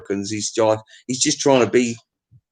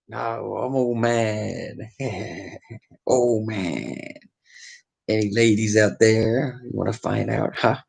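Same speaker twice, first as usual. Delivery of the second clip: low, slow macho voice; lightly voiced lounge lizard; macho man; 3 semitones lower, 5 dB quieter, slower than usual. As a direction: play a cheesy ladies' man.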